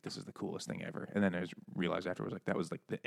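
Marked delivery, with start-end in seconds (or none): 0.0-1.1 hushed, voiceless awe; whispered awe. Retold or imitated. Kid whispering about his new Walkman. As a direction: breathe it out in quiet wonder. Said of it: "And it's like".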